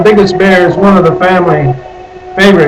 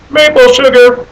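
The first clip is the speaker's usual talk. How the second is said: delighted maple sugar; 8 semitones higher than usual. Acted. Dreamy aside about favorite candy.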